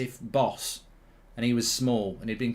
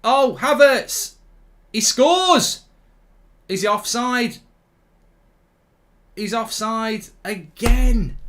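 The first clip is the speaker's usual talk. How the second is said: excited shout; 8 semitones higher, 8 dB louder, much slower than usual. Real, not acted. Goal excitement then offside.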